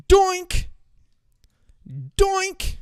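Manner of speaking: very high cartoon doink; high silly kick noise; doink sound effect.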